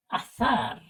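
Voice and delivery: azar said with a Castilian th sound; Spanish lisp on z. Shown as a demonstration. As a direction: say azar as "athar", z like English th. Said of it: "En la primera diría". (azar con zeta española, como "θ")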